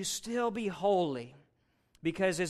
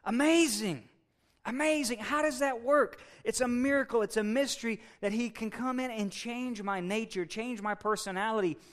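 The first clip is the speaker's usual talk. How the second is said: higher, excited wonder with stretched words; overwhelmed amazement; 6 semitones higher than usual. Acted. Believer marveling that the Holy Spirit inhabits the heart, amazed and excited. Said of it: "amazement and I get overwhelmed and excited".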